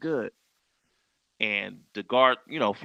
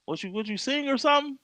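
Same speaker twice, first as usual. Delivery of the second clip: much higher, puzzled retelling; imitated curious question; 12 semitones higher, faster than usual. Lazy Bone quizzes a backstage visitor, imitated curious question. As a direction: quiz them in a lifted pitch.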